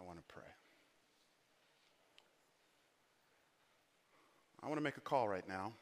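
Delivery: soft, low, solemn invitation; altar call. Real, not acted. Preacher inviting the congregation to pray, soft and solemn. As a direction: invite them gently, with long pauses.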